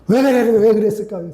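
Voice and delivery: ordinary talk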